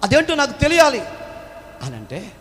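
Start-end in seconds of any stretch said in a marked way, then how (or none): 0.0-1.0 higher, louder, urgent questioning; wounded soldier pleading. Acted. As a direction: demand to know why she weeps. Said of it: "ఆయన అంటాడు".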